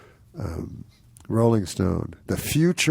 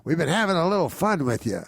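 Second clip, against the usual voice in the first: higher, chummy mock organizer; quoting the prankster; 8 semitones higher than usual. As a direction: sound pleased with the prank, pitched up.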